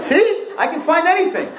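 high-pitched, pleased-with-yourself brag; mock proud boast. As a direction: crow about it with put-on pride. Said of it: "you feel, like, so proud".

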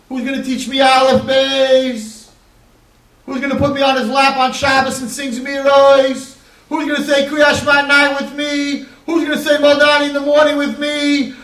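put-on a child sobbing for his father, rising to a wail; crying child. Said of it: "children are sitting next to her crying".

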